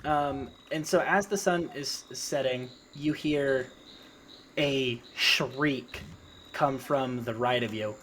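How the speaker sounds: ordinary delivery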